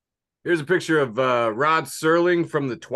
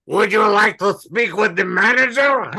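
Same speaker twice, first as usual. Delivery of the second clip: higher, louder snooty manager demand; mock entitled customer; 6 semitones higher, 5 dB louder than usual. Joking about the alien lady, mock snooty manager voice.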